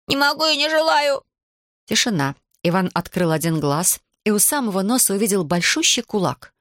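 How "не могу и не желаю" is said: high-pitched cry of refusal; defiant cry. A narrator's reading. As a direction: cry out stubbornly, pitch raised. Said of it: "он крикнул". (упрямый выкрик высоким голосом)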